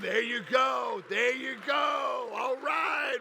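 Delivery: loud, drawn-out cheer; rally the crowd with a shout. Real, not acted.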